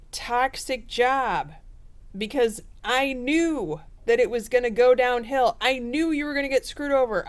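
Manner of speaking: gloating I-told-you-so, playfully hammed up. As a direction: Scorpio lecturing the sibling, mock scolding tone. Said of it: "you're gonna say".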